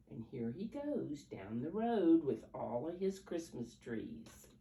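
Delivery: ordinary delivery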